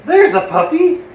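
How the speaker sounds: high, loud, delighted exclamation; excited discovery. Acted. Puppet spotting a puppy in the store, excited. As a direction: burst out as if just spotting it.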